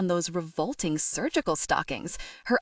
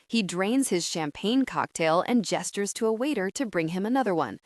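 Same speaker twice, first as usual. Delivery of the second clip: ordinary delivery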